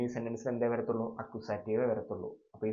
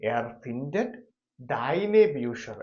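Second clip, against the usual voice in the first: very slow, louder dictation; 7 dB louder, much slower than usual. Shown as a demonstration. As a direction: stretch the words for writing. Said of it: "അപ്പൊ എങ്ങനെ എഴുതും?".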